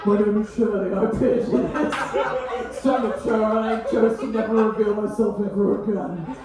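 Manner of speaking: lightly dubbed actor voice; actor voicing Shirley. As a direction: play the hidden audience member's dub. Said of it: "voiced by an actor".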